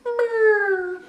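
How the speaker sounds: high, plaintive lemur call; lemur lost call. Shown as a demonstration. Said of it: "plaintive lost call that sounds like this".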